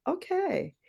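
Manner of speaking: high, drawn-out surprised okay; a high okay of disbelief; taken aback, trying to go along with it; stunned okay. Retold or imitated. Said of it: "And I was like".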